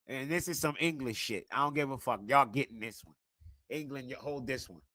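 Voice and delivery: ordinary talk, nothing marked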